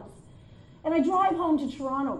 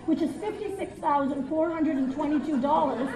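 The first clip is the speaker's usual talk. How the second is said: normal talking voice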